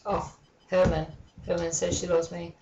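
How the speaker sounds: ordinary delivery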